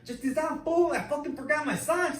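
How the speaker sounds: high, loud hammed-up gangster voice, laughing through it; super gangster. Cellmate begging the teacher to go back, hammed-up gangster voice. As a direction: play the cellmate as an over-the-top gangster. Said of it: "he talked, like, super gangster".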